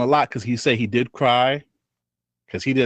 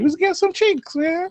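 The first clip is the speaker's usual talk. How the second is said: high-pitched joke. Real, not acted. Joking pitched-up remark.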